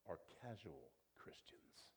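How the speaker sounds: hushed, slow whisper. Acted. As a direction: drop to a confiding whisper. Preacher drops to a whisper.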